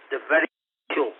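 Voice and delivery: usual speaking voice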